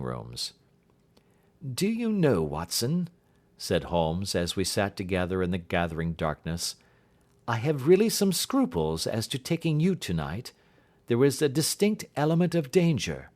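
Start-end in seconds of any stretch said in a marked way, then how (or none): 1.6-13.3 higher, brisk and assured detective; confident detective reassuring a frightened client; detective's voice. Acted. Detective bidding the client farewell, assured and higher.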